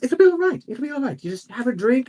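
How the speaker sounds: high, sing-song reassurance; coaxing noble lady. Acted. Noble lady urges the guard to drink, high and coaxing.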